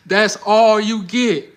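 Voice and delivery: stretched, drawn-out come-on; slow flirty tease. Shown as a demonstration. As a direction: drag each word out like a coy Southern woman. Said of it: "Let me show you again".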